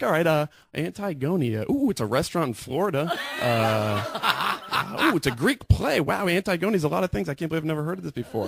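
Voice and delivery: high, wondering office-lady voice; imitating amazed coworker. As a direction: play a coworker marvelling as she looks it up. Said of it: "She's like".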